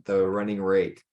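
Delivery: usual speaking voice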